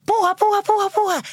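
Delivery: high, loud retold doctor yelling push; a mock doctor barking push at the mother; doctor shouting push. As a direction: egg her on like the doctor at delivery. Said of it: "Qué es lo que tú estás cansada".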